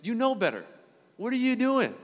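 self-scolding. Retold or imitated. Talking to himself about his struggles: scolding. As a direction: scold yourself like an exasperated parent. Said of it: "You ever had those conversations?".

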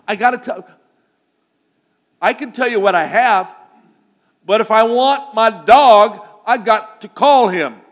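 loud, high, urgent insistence; worked-up pleading. Retold or imitated. Boy tells the neighbors he has to call his dog, loud and urgent. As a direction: insist over the crowd that you must call.

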